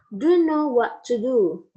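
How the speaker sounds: "Do know" run together as dunno, slow and deliberate; reduced "dunno". Shown as a demonstration. Teacher showing a reduced phrase to students, slow dunno. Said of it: "derived from don't know".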